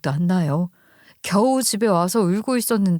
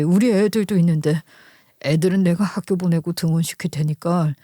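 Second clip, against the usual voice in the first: lightly voiced husband, a bit lower; caring husband; 3 semitones lower than usual. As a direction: look after an ailing wife with mild concern. (낮은 톤으로 아내를 챙기는 남편)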